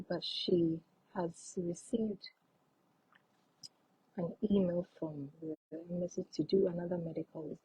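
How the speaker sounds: whispered reading. Real, not acted. Reading a viewer's question aloud.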